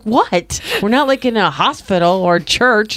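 lightly acted disbelief; mock protest. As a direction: playfully argue back at being shushed. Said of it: "I'm like".

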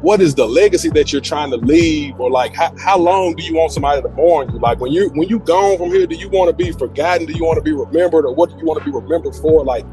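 ordinary talk, nothing marked

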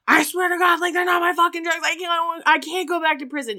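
high, pleading mock panic; retold sobbing panic. Husband on probation pleading with the cops, mock panicked. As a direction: beg frantically, imitating a man falling apart. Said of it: "He is sobbing".

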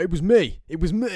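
loud, high crowing; imitating a boastful champion. Guessing how Henry will claim credit, mock boasting. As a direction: shout it as a hammed-up braggart. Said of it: "he'll be like".

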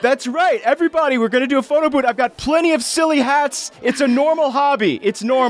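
high-pitched, over-keen pitch; Zerk, eagerly covering up. Acted. Zerk announcing a photo booth to onlookers, high and over-keen. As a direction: sell it to the crowd, high and insistent.

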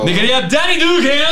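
host welcome. Acted.

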